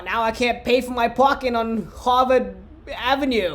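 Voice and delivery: put-on a light Boston accent, dropped r's; mild Boston accent on Harvard Avenue. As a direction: play up the Boston accent a little. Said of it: "was that a Boston accent?".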